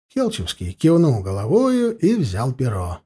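ordinary delivery, nothing marked

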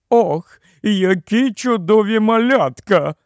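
high-pitched queenly gushing; queen's impulsive cry of admiration; delighted exclamation. A narrator's reading. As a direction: exclaim it high, unable to hold back. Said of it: "не стримала вигуку королева".

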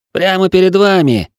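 hurt, sulky reply; offended. A narrator's reading. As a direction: say it with a wounded pout. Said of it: "обиженно сказал Тиль".

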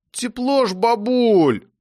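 put-on high childish whine; boy whining to grandma about the warm weather. A narrator's reading. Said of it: "заныл Серёжа".

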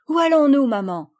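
bright call to mother; eager child question. A narrator's reading. Camille asks her mother where they go, eager child voice. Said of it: "dit Camille".

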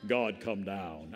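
deep, slow booming radio prayer. Retold or imitated. Pastor imitating friend's booming radio voice. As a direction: pray like a radio announcer. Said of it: "Dennis's voice sounds like somebody on the radio".

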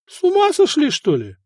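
high surprised exclamation. A narrator's reading. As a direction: gape in disbelief. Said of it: "удивлённо раскрыл рот Арат".

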